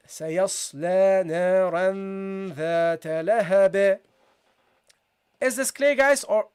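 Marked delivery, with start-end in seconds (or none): 0.1-4.0 slow, chanted "سيصلى نارا ذات لهب"; measured Quranic recitation of "سيصلى نارا ذات لهب", bouncing the last sound; demonstrated recitation. Shown as a demonstration. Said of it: "so you read".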